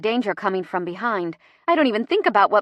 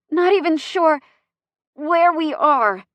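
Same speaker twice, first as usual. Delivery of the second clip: panting in pain; 8 semitones higher, 5 dB louder than usual. Acted. Laboring woman pants.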